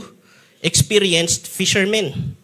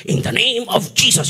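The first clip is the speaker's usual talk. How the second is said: pious rebuke; 4 semitones higher than usual. Shown as a demonstration. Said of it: "Ah, ganito".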